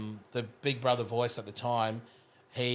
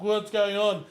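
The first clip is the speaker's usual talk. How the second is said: higher alarmed question; startled; 9 semitones higher, 4 dB louder, slower than usual. Retold or imitated. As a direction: panic at the sudden voice. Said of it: "I was like".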